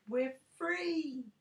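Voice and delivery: high joyful shout; freed slaves shouting with joy. A narrator's reading. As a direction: cheer it out together. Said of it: "All the slaves shouted".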